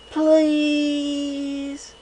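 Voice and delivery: very high, loud drawn-out please; three fillies begging together; chorused plea. A narrator's reading. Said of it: "they beamed up at me and chorused".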